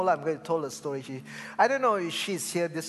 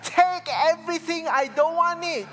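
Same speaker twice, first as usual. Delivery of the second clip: high, loud retold outburst; panicked refusal; 13 semitones higher, 8 dB louder than usual. Colleague fleeing the durian smell, high panicked shout. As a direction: cry out in horrified refusal.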